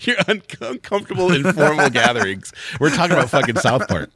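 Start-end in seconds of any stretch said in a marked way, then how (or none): 0.1-2.5 laughing mock repeat. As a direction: tease through laughter. Teasingly repeats co-host's stiff phrase.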